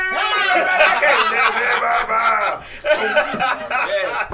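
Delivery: laughing loud and high. Real, not acted. Cohost laughing at the lioness joke, cracking up. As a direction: crack up through it.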